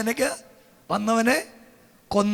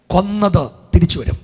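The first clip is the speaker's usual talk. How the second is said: normal talking voice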